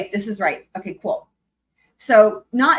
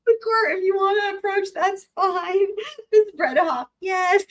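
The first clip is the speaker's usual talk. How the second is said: judge's voice cracking with laughter; laughing; 10 semitones higher than usual. Real, not acted. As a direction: try to read it but keep breaking up. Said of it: "I'm laughing so much".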